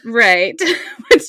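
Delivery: laughing through the word. Real, not acted. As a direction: laugh while you answer. Laughing at the story.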